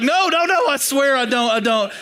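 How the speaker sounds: high, hurried mock-panicked protest; acted panicky backpedalling; frantic denial. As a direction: blurt the denial high, then ease down. Retold frantic denial to fiancée.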